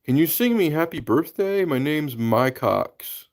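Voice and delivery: mocking troll. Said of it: "One guy was like".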